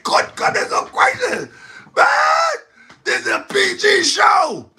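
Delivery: high, shouted mock disbelief; shocked outcry. Host retelling his reaction to a wild show, high shocked cry. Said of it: "I said".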